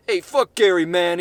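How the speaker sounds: loud, high, brash yell; protester shout. Acted. Young protester yelling into the mic. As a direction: yell it at the camera like a fired-up protester.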